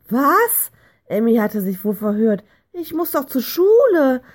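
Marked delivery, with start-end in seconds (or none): 0.1-0.7 high, louder startled question; shocked disbelief. A narrator's reading. Child shocked by school ban. 2.7-4.2 high, louder dismayed protest; startled. A narrator's reading. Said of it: "sagte sie erschrocken".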